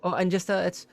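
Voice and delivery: high, eager innkeeper voice; fussy innkeeper. Acted. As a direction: play a flustered, helpful innkeeper pitched high. Innkeeper lists drinks.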